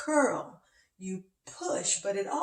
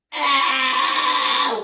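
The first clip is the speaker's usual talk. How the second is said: long, loud, higher "aaah"; mock pain yell; 5 semitones higher, 10 dB louder than usual. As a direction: yell a drawn-out "ah" of imitated pain. Imitates back catching in pain.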